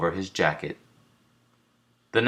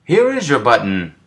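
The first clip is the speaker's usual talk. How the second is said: Frog excited; 6 semitones higher, 7 dB louder than usual. A narrator's reading. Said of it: "said Frog".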